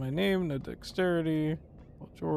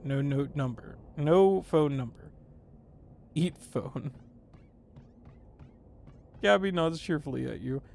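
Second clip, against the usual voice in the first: ordinary talk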